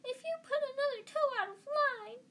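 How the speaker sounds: very high, loud mimicry of a scolding mother; shrill mock scolding, held high throughout; shrill, piercing. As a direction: screech it in a mocking falsetto. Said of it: "he put on a shrill, piercing voice".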